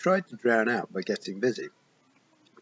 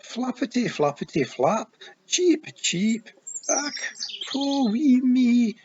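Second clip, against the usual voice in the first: high, sing-song Scottish cheeping, "poor wee me" drawn out; a wee man hamming up a hurt baby bird; Scottish feegle squeaking like a chick; fake bird cheeping; 5 dB louder, slower than usual. Feegle fakes a wounded bird.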